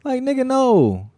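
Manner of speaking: high, loud, drawn-out no; retold incredulous refusal. Host retelling reply to party invitation, incredulous refusal. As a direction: stretch the refusal out in mock disbelief.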